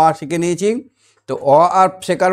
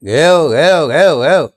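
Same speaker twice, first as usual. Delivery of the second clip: loud slow barking noises; mock dog barking; 7 dB louder, slower than usual. Shown as a demonstration. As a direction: bark out each woof. (কুকুরের ডাক নকল করে)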